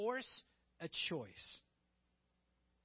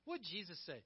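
ordinary talk, nothing marked

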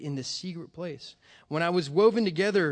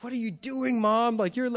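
higher-pitched mock plea; child's bewildered protest, acted out higher; distraught protest, retold; 7 semitones higher, 4 dB louder than usual. Child questioning mom as brother leaves, retold high-pitched protest.

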